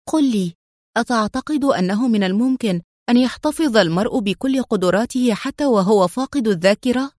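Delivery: ordinary delivery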